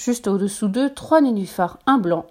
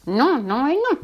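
stamping, higher and indignant no; angry refusal; 6 semitones higher, slower than usual. A narrator's reading. Blackbird refusing more mice, indignant and higher. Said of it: "avec colère".

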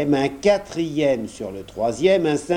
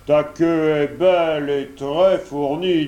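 lightly voiced sly wolf; a hint of a flattering wolf; coaxing wolf, lightly played. A narrator's reading. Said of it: "dit le loup".

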